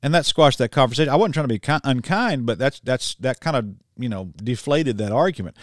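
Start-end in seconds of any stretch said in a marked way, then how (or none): none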